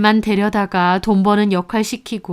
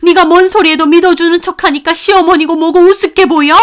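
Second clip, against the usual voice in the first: loud, high furious tirade; angry mother-in-law berating her son's wife; furious scolding; 10 semitones higher, 10 dB louder than usual. Acted. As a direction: dress her down hard. Grandmother berates daughter-in-law.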